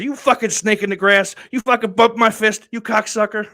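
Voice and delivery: high, loud hammed-up insults; mock outrage. Comedian scolding the guest for the fist bump, mock angry. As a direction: rant like a betrayed friend. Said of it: "Kevin got me".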